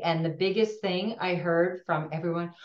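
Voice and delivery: normal talking voice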